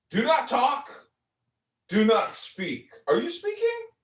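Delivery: high, loud, slow commands; barking judge. Retold or imitated. Mocking the judge who silenced him, loud and high. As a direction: bark it like a tyrant judge.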